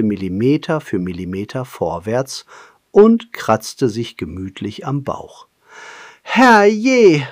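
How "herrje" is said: high, loud, fed-up sigh of a word; little monkey groaning, higher and louder; exasperated monkey groan. Acted. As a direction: throw up his hands in despair. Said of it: "stöhnte Pippo".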